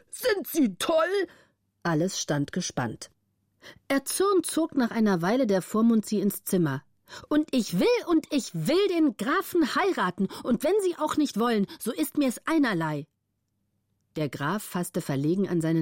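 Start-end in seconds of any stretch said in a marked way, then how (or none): 0.1-1.3 high, slow outraged cry; guardian's outcry. A narrator's reading. Guardian crying out at the defiant countess, outraged. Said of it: "des Vormunds Geschrei". 7.3-13.1 a hint of a stubborn young countess; lightly voiced, insisting; defiant young countess. A narrator's reading. Countess insisting on marrying the count, stubborn.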